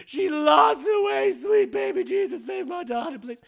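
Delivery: high, slowed mock lament for a lost daughter; wailing mother. Miss Sarah crying out for her lost daughter, mock wailing. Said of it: "Miss Sarah's like".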